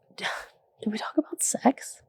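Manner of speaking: hushed, breathy whisper, teasing; whispered question. Acted. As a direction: lean in and whisper it like a secret. Rhetorical question to the audience.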